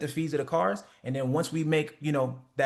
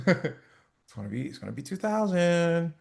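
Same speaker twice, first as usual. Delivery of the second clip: slow, halting, unsure; timid hesitant price; 2 semitones higher, slower than usual. Shown as a demonstration. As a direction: stall before the number. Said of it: "you say that shit like this".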